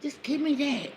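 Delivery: high, soft pleading; mock pleading. Begging her man for it, high mock plea. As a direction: beg like a needy girlfriend. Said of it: "I was like".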